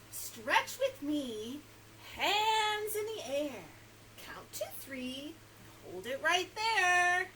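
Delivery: slow, stretched-out picture-book read; reading to children. Acted. Storytime host reads stretching book to children, slow and sing-song. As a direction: draw out each phrase with big energy.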